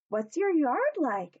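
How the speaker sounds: a timid small toy asking. Acted. Benji tells the hippo about cupcakes, high and unsure.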